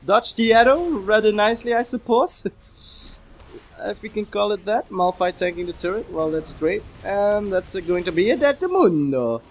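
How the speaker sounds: high-pitched hammed-up fake Italian accent; bad Italian accent. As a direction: play a cheesy Italian taunting the enemy in a bad Italian accent. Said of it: "Why am I speaking with bad Italian accent?".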